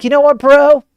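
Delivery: hammed-up Hugh boasting to the world; cocky bro voice. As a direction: brag like a know-it-all buddy. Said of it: "He's like".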